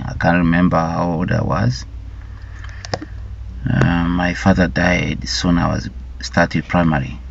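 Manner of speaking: ordinary talk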